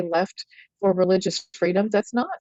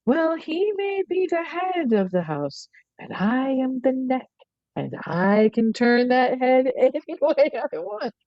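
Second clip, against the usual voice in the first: higher, slow, put-on knowing wife voice; movie wife quote; 6 semitones higher, slower than usual. Movie wife boasting to her daughter about her husband, higher, slow and sly. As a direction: play her as sly and self-assured, stretching each line out. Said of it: "the woman says".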